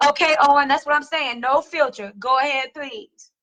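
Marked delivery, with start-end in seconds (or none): none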